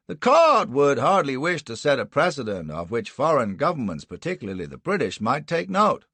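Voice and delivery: added quickly. A narrator's reading. Anderson heads off the prosecutor's outburst, hurried. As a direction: get it in before you are cut off. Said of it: "Anderson added quickly".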